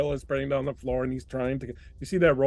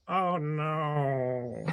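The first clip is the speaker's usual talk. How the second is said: little voice; 3 semitones higher, 5 dB quieter than usual. Acted. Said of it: "you hear a little voice that goes".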